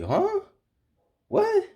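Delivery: very high incredulous yelp; retold disbelief. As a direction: pitch shoots up in mock shock. Retold shocked reaction to manager.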